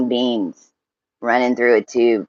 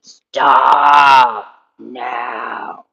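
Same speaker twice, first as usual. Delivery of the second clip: shouted command. Retold or imitated. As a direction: bark it out like an order to halt. Repeats command more forcefully.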